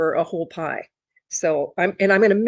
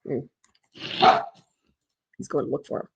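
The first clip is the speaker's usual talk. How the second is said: usual speaking voice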